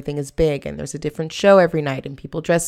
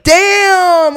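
much higher and louder, stung; shocked exclamation; 10 dB louder than usual. Retold or imitated. Hearing herself called fat, shocked yelp. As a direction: blurt it out in shock.

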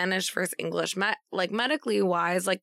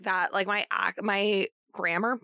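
normal talking voice

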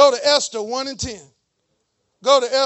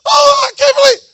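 much higher, louder hammed-up wail of disbelief; mock shocked girl; 11 semitones higher, 12 dB louder than usual. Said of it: "I seen girls".

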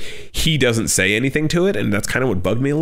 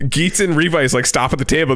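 ordinary delivery

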